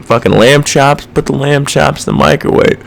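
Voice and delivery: mock clueless cook. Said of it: "and it's like".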